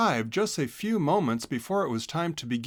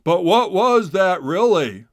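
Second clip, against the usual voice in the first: loud call, much louder; yelling after him; 6 semitones higher, 10 dB louder than usual. A narrator's reading. Otter yelling after the fleeing hedgehog, loud. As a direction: shout after him as he runs. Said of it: "Mister Otter yelled after him".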